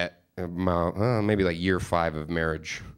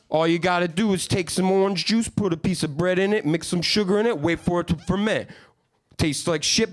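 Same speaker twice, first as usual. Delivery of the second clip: Steve voice; 8 semitones higher than usual. Retold or imitated. Dumb hill kid brags to Brian about jail, in a high dopey voice. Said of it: "Ignorant Steve would come up and be like".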